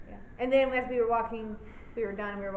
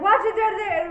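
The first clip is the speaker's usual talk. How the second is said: alarmed warning; 9 semitones higher, 9 dB louder than usual. Retold or imitated. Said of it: "'cause you were like".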